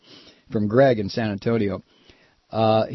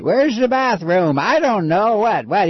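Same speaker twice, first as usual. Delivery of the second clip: put-on high, befuddled old man; Strom Thurmond; 7 semitones higher, 4 dB louder, slower than usual. Host mocks an aged senator, high confused old-man voice. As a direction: play a lost, confused old senator, pitched way up. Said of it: "he's like Strom Thurmond".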